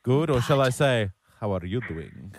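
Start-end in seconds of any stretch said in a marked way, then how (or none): 1.4-2.3 low, soft mock foreign accent; botched suave accent, low and quiet; Puss in Boots. Host greets caller in a foreign accent, low and soft. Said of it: "was that my Puss in Boots".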